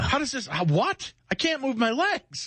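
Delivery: high, squealed comic alarm; mock panic. Bathroom scroller with numb legs, high mock panic. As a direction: push the pitch way up in hammed-up dismay.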